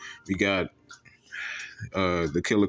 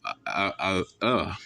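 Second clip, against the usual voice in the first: stuttering. Real, not acted. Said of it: "stuttering today".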